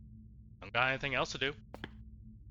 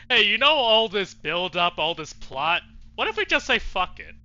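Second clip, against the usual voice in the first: excited pitch; 7 semitones higher, 10 dB louder than usual. Real, not acted. Player urging the Princess to quit the story, excited. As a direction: sell the idea with rising excitement.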